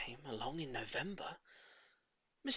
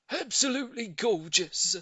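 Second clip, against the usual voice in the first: higher, louder, too keen; overdone agreement; 8 semitones higher, 8 dB louder than usual. A narrator's reading. Neighbour faking delight over the tortoise, overdone. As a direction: agree a bit too eagerly. Mr Hoppy not meaning it.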